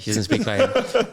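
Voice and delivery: high, laughing aside. Real, not acted. Son laughing at his own joke about mum, high and giggly. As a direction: laugh through the words.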